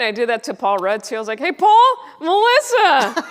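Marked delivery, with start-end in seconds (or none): 1.4-3.2 very high, loud, stretched out name; overeager greeting. Retold or imitated. Greeting Paul Rudd at a party, very high and loud. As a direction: shout your name like a fan.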